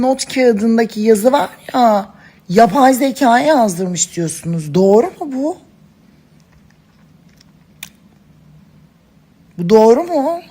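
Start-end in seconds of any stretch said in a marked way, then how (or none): 4.7-10.4 outraged disbelief. Real, not acted. Outraged at AI-written note.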